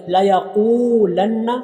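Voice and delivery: slow chant, क़ाफ in यक़ूलन्ना held long; Quran recitation. Shown as a demonstration. Teacher showing students the long क़ाफ, chanted. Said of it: "क़ाफ को लंबा करेंगे".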